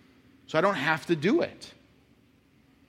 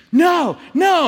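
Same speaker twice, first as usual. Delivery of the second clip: much higher and louder, a bratty yelled "no"; teen tantrum refusal; 13 semitones higher, 9 dB louder than usual. Retold or imitated. Teenage daughter refusing her mom, mock bratty yell. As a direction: shriek it like a defiant fourteen-year-old.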